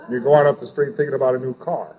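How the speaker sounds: ordinary talk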